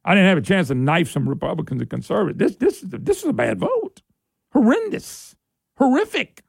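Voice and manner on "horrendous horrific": emphatic disgust. Real, not acted. Host condemning the vote.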